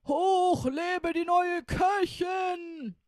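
high, loud, slow cheer; cheering shout. Acted. Robbers cheering the new cook, loud rowdy shout. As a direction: roar a mocking toast. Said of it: "brüllten die Räuber lachend".

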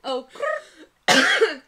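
"crap" with a loud trilled r, rrrap; rolled r. Shown as a demonstration. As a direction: roll the r in "crap" hard. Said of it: "teach Wayne how to say it".

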